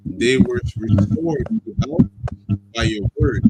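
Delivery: slow, solemn Bible reading; scripture reading. Acted. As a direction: read it like scripture. Reads scripture aloud.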